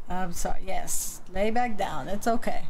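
ordinary delivery

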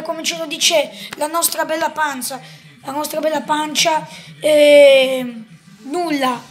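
ordinary talk, nothing marked

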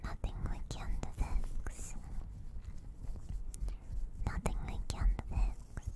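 normal talking voice

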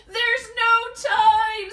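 no time to lose; urgent shout. Acted. Panicked rescue.

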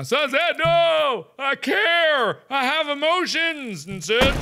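high, hammed-up distress; mock anguish. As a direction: wail it in overdone fatherly grief. Hammed grief over game son.

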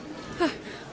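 soft tired breath out; weary sigh. Acted. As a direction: let out a drained sigh. Worn out after panic.

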